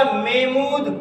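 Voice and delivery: محمود as "MAY-mood"; common mispronunciation. Retold or imitated. Teacher mimicking an answer about names, common mispronunciation. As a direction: lose the h in محمود. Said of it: "لوگ کیا پڑھتے ہیں".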